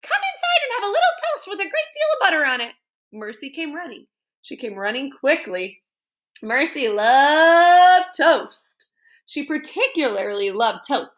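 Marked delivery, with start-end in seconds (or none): 0.0-2.7 very high, fast sing-song coaxing; Mrs Watson voice. Acted. Mrs Watson calling Mercy. 6.4-8.8 very slow, loud, relishing; savouring. A narrator's reading. Narrator savours line.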